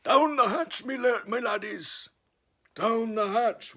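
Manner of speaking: loud, very high pirate roar; pirate yell. Acted. Braidbeard yells at dinner, loud pirate roar. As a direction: bellow the toast, fist on the table. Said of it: "and yelled".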